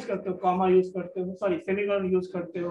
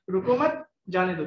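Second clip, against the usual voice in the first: "रोको मत" as one rising phrase, then falling "जाने दो"; pause after मत; 3 semitones lower than usual. Shown as a demonstration. Second courtier reads the order, pausing after मत. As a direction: keep मत joined to रोको, then drop on "जाने दो". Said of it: "दूसरे ने पढ़ा".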